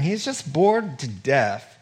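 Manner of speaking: normal talking voice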